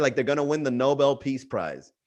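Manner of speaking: ordinary talk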